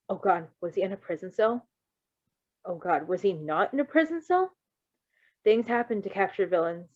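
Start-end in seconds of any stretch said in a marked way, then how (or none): none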